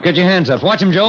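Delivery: sharp loud command to drop everything; shouted order. Acted. Detective ordering robbers to raise hands, loud. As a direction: bark it at the suspects. Arrest at gunpoint.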